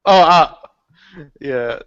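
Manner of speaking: ordinary talk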